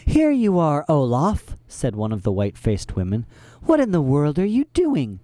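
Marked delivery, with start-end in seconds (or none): 0.1-1.5 high eerie woman voice. A narrator's reading. Troupe woman finding Olaf in the kitchen, eerie high voice. 3.6-5.2 high eerie woman voice. A narrator's reading. Troupe woman finding Olaf in the kitchen, eerie high voice.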